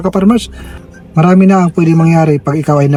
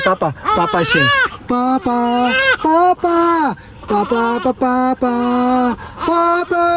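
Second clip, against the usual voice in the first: higher and softer, crooning Papa over and over; 9 semitones higher, 3 dB quieter, slower than usual. Real, not acted. Father telling crying baby Papa is here, soft crooning.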